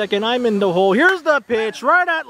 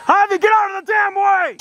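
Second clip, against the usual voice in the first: high, urgent yell; alarmed shout; 9 semitones higher than usual. Real, not acted. Commentator yelling at Javi to clear the ball's path, alarmed shout. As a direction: shout a real warning. Yelling warning as ball comes.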